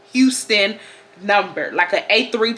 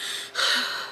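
imitated heavy breathing; 11 dB quieter than usual. Storyteller imitating a caller breathing on the phone, heavy breathing. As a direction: breathe heavily into the phone, wordless. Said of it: "All I hear is".

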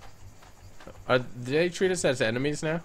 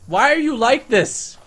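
exasperated; 9 semitones higher, 8 dB louder, slower than usual. Real, not acted. Exasperated at other raptor.

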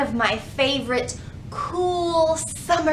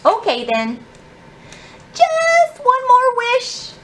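put-on higher, pleading child's voice; whiny little girl asking for more; little girl Ruby; 14 semitones higher, 5 dB louder, slower than usual. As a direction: beg like a small girl who always wants more. Ruby keeps begging.

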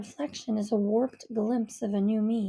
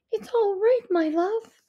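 comforting mother; 9 semitones higher, 4 dB louder than usual. A narrator's reading. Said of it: "she breathes".

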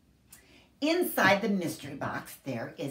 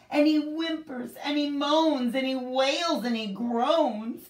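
whimpering; 7 semitones higher, 3 dB louder than usual. A narrator's reading. Bear wailing.

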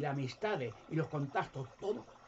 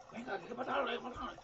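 mob cry; 9 semitones higher, faster than usual. Retold or imitated. Pharisees urging the killing.

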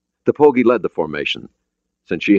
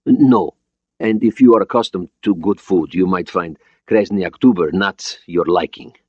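put-on Russian-accented, careful English; deliberate phrasing with a Russian accent; foreign accent; 2 semitones higher, 3 dB louder than usual. Borodin warning about his boat's food, Russian accent. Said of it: "He had an accent, but his English was understandable".

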